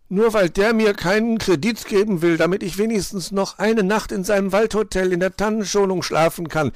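high, loud bickering complaint; griping. A narrator's reading. Broke player complains about refused credit, loud griping. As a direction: rant on indignantly without letting up. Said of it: "meckerte Luzi".

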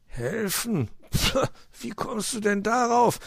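incredulous high question with a pff; taken aback, dismissive; scoffing. A narrator's reading. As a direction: scoff at an absurd suggestion. Fox balks at helping.

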